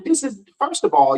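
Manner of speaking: ordinary delivery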